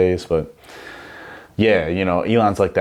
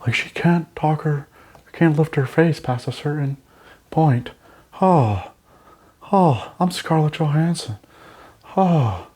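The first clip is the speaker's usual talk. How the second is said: high, stiff-faced mock Scarlett Johansson voice; breathy mock-celebrity purr, face frozen; Scarlett Johansson impression; 10 semitones higher, slower than usual. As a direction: talk like your face can't move, pitched way up. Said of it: "She kinda talks like she has too much Botox".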